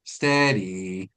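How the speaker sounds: low, drawn-out "steady"; low calming word to a horse; low soothing. Shown as a demonstration. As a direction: draw it out low to calm the horse. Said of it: "those kind of tones".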